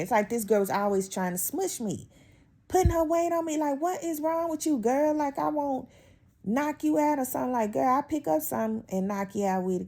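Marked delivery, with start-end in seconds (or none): 2.7-9.9 high, worked-up rant; exasperated rant. Real, not acted. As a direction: pitch way up, fed up. Ranting about coworker's leaning.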